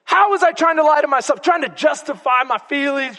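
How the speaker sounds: usual speaking voice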